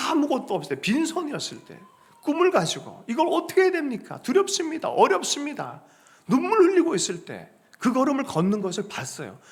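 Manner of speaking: high, rising plea; impassioned preaching. Acted. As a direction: pour feeling into each phrase. Emotional preaching climax. (높은 목소리로 절절하게 설교하듯)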